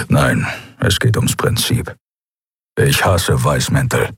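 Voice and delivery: low, slow grumbling creature voice; lightly voiced gruff alien; gruff Keru. A narrator's reading. Keru's voice.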